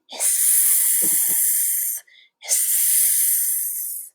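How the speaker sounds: long, soft voiceless hiss; drawn-out breathy snake hiss. Shown as a demonstration. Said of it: "And a snake goes".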